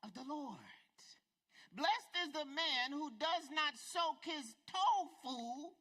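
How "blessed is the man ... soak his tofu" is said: rising preacher cadence, stressed blessed; preaching. Acted. Preacher riffs a beatitude to the congregation, proclaiming.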